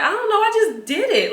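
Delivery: half-laughing exasperated cry; exasperated outburst. Retold or imitated. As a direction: blurt it out, fed up. Exasperated reply to friends.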